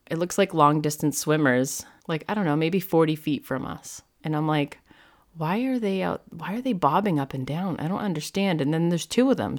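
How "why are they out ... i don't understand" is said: puzzled, higher-pitched wondering aloud; confused. Retold or imitated. Surfer spotting odd shapes bobbing in water, confused. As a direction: act out baffled questions to yourself. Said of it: "So I'm really confused".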